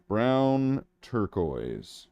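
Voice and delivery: "Brown turquoise" said very slowly, stretching "Brown"; mock grand slow naming; drawn-out name. Shown as a demonstration. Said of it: "That's not what it's called".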